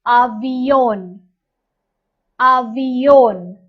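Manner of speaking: avión said slowly, stress on the final ón; teacher pronouncing Spanish; slow Spanish word. Shown as a demonstration. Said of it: "It's called avión".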